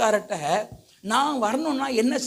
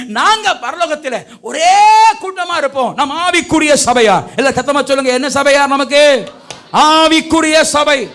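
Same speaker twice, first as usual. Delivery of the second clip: rally the crowd, stretching the key words; rousing preaching; 3 semitones higher, 8 dB louder than usual. Real, not acted. Preacher getting the congregation to name their church, rousing preaching.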